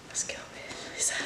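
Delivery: breathless, barely voiced words; winded, gasping between words; out of breath. Real, not acted. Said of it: "I don't know why I'm out of breath".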